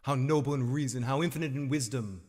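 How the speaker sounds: Shakespearean monologue. Acted. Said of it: "I'm not gonna do the whole monologue".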